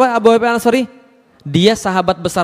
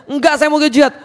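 stubborn insistence; 8 semitones higher than usual. Acted. Acted stubborn old man insisting.

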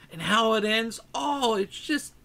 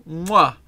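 chef's kiss smack; 7 dB louder than usual. Pretend.

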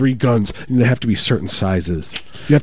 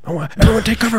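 very high put-on warning cry; mock alarm; 12 semitones higher, faster than usual. Warning everyone to take cover, high mock-alarmed voice. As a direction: sound the alarm in a pitched-up voice.

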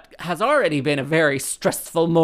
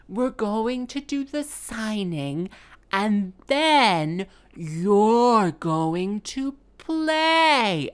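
very slow, high, drawn-out patronizing voice; condescending vicar; 6 semitones higher, much slower than usual. Retold or imitated. Vicar explains the order to the musician, condescending. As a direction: spell it out as if to someone slow. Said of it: "This is how he talked to me".

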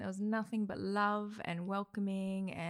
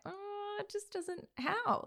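exasperated disbelief; 14 semitones higher, much slower than usual. Retold or imitated. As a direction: squeak out baffled disbelief. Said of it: "I was like".

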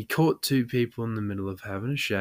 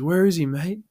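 louder, higher cop question; mock cop shout; 7 semitones higher, 6 dB louder than usual. Police officer asking the drunk, mock shouted.

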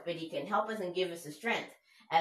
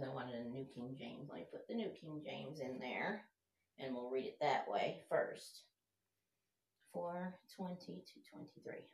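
muttering to himself; 3 semitones lower, 10 dB quieter than usual. Real, not acted. Muttering while searching device.

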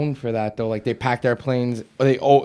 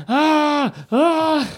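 two high, loud mock screams; imitated screaming; 14 semitones higher, 4 dB louder than usual. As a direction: mimic a hysterical man's screams. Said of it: "how he was screaming".

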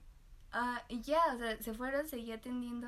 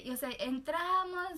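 ordinary talk, nothing marked

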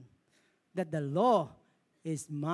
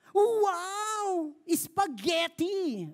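mock delight; 10 semitones higher, 3 dB louder, much slower than usual. Mock delight at favourite food.

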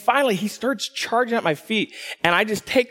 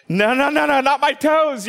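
high, rapid-fire mock panic; comic panic; 6 semitones higher than usual. Barefoot man as the raccoon comes at his feet, comic panic. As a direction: plead in a high, hammed-up fright.

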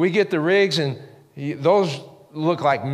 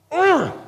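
groan; 14 semitones higher, 5 dB louder than usual. Retold or imitated. Line breaks, disappointment.

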